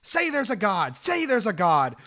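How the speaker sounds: half-contained imitation of an attacker's scream; retold scream. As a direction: imitate someone yelling a repeated demand. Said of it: "screaming all the while".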